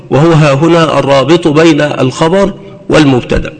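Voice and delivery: ordinary talk, nothing marked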